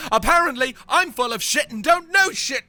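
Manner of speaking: high, loud self-berating rant breaking into sobs; distraught outburst. Acted. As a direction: shout in despair, then crumble into tearful whimpering. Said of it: "very distraught".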